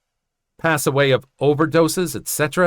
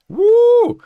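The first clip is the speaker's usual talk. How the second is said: high loud whooping cry; high, loud whoop of disbelief; 8 dB louder than usual. Real, not acted. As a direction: let out a big high "woo". Whoop after telling teacher's insults.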